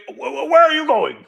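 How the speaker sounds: high, stammering surprise; flustered surprise. Acted. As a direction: stammer it out, caught off guard. Lorix flustered.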